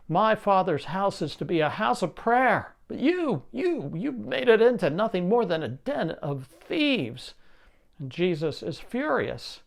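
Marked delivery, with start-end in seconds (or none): 0.1-7.3 rising rebuke, pitch leaping higher on "you"; angry rebuke. Acted. Jesus rebuking the money changers in anger, voice climbing high. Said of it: "He looks at them with anger and says".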